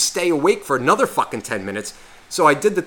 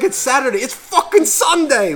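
movie quote yell; 12 semitones higher, 5 dB louder than usual. Retold or imitated. Host corrects the Shaun of the Dead quote, yelling high. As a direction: ham up the confused shout, drawing out Sunday. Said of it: "No, no, no, they're like".

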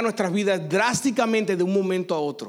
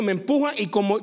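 ordinary talk

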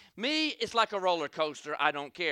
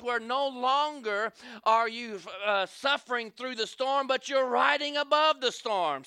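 preaching; 4 semitones higher, 3 dB louder than usual. Acted. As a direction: preach it out and build toward the climax. Preacher building to the storm image.

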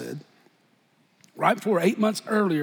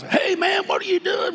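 buddy calling out; 12 semitones higher than usual. Retold or imitated. Buddies calling at the door.

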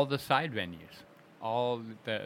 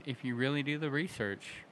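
ordinary talk, nothing marked

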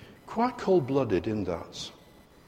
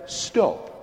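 put-on firm command to child; 6 semitones higher than usual. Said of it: "We say".

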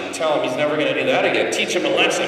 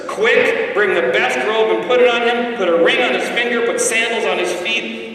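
father's orders; 8 semitones higher, 4 dB louder than usual. Retold or imitated. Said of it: "gives the orders ... to the servants".

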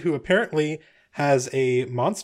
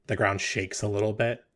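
usual speaking voice

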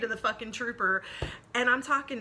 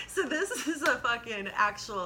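normal talking voice

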